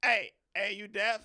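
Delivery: loud, high mocking call; taunting yell. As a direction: shout it up the hill to taunt him. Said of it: "we was like".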